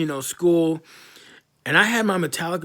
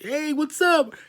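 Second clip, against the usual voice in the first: much higher, sugary mock hey what's up; fake friendly greeting; 13 semitones higher, slower than usual. The bully greets him at a reunion, fake-innocent high voice. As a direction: play the bully acting all innocent.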